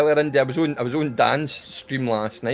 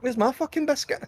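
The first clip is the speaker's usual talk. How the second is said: mock outrage; 13 semitones higher than usual. Mock indignant after laughing.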